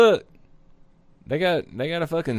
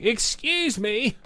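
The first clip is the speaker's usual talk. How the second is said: mock polite cat; 7 semitones higher, 4 dB quieter, slower than usual. Cat voiced as polite passerby.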